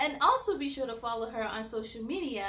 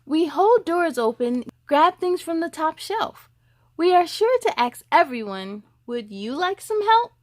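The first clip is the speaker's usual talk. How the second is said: lively picture-book read, louder; reading to children; 3 semitones higher, 11 dB louder than usual. Acted. Host reads a boy's story to young viewers, louder storybook voice. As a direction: read it out to the little ones, bright and full.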